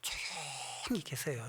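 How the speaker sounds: 천천히 또박또박 타이르듯; 말을 늘이며 조용히 당부. Retold or imitated. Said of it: "아무 소리 하지 마". (천천히 달래듯 당부)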